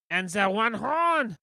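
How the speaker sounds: loud, high, slow barking guard. Acted. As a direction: bellow like a pompous royal guard. Guard list continues.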